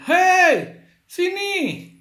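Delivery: high, loud calling-out; shouting to a friend. Shown as a demonstration. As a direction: holler a friend over. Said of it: "berteriak memanggil teman". (berteriak memanggil teman)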